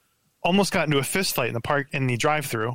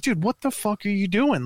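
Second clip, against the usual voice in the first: retold angry yell; 7 semitones higher than usual. Retold confrontation with rude driver.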